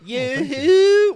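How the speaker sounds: loud high Swedish chef call; Swedish accent. Pretend. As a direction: belt out a Swedish chef yoo-hoo. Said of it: "in a Swedish accent?".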